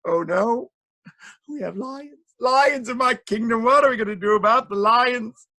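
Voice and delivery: high, fretful mock wail; panicking king. A narrator's reading. King discovering lions in his kingdom, high panicky cry. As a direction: climb into loud, high alarm.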